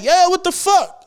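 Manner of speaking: high, drawn-out shocked yell; startled outburst. Retold or imitated. Driver startled by jaywalker, high drawn-out shock. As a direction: react like someone stepped in front.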